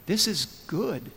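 calmly insist, as the ever-positive friend; eternal optimist. Retold or imitated. Jailed friend answering the apologetic king, slow and softly optimistic. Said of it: "his friend is this eternal optimist".

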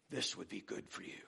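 soft, quick, coaxing aside; hushed, persuasive murmur; quiet temptation. Acted. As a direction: slip it in softly like a tempter. Devil's lie tempting the lonely.

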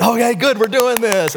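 high, eager burst; excited relief. Acted. Congregation joining in the applause, excited burst. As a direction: imitate a crowd suddenly let loose. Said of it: "they sort of broke the dam".

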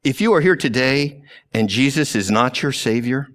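usual speaking voice

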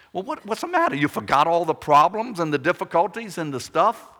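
high, loud incredulous question; mock disbelief. Preacher voices a doubter's surprised challenge to David, mock incredulous. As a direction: act amazed that he forgot the problems.

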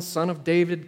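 ordinary talk, nothing marked

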